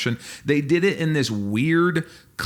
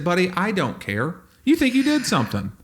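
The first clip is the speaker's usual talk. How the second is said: mock scolding; 6 semitones higher than usual. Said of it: "I said".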